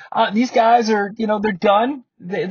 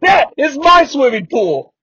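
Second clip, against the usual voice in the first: slow, louder, grandly proud; slow proud announcement; 5 semitones higher, 5 dB louder than usual. Retold or imitated. Host quoting a man proudly pointing at his creek, slow and grand. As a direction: show off a muddy creek with mock pride. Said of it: "he goes".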